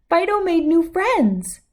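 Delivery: thrilled to show them; delighted child. Acted. Abigail delighted at birds.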